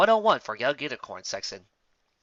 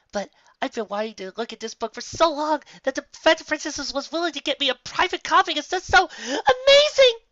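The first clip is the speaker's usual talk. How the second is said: rising higher and louder to a squeal; gushing excitement; 7 dB louder than usual. Acted. Twilight to Spike, thrilled about the book, squealing. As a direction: build from eager to overjoyed squeal.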